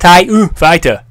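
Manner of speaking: slow, high, very loud drawn-out words; shouted mic test. Shown as a demonstration. Retrying the waveform trick, slow shout. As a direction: bellow it with the first word stretched. Second louder attempt into mic.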